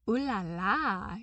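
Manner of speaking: hammed-up admiring coo; mock impressed. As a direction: pretend to be wowed. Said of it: "he pretended to be impressed".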